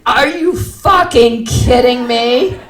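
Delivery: loud, high, slow-stretched disbelief; outraged exclamation. Acted. Customer screaming inwardly at the clerk, loud outrage. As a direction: burst out in exasperation.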